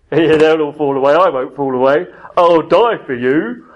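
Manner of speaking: loud put-on swagger, last words slowed; mock boastful Peter. Preacher mocks Peter promising to die for Jesus, boastful. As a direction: ham up Peter's bravado. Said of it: "it probably doesn't say it like that".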